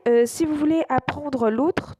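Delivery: ordinary delivery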